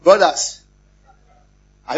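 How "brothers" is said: loud call to the men. Real, not acted. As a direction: summon the brothers. Preacher calls out to men.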